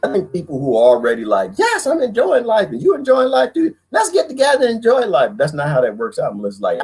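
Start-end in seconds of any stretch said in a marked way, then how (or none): 1.6-5.3 high, bright sing-song; hammed-up happy-go-lucky voice; mock cheerful person. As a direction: gush about enjoying life. Imagined happy single person.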